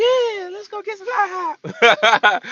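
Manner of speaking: very high, slow squeal of delight; excited girl impression. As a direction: squeal it in a mock, drawn-out falsetto. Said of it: "She'll be like".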